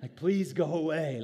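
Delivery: mock exasperated plea. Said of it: "I'm like".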